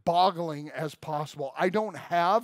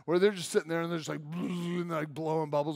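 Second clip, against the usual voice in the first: ordinary talk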